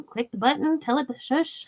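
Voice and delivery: usual speaking voice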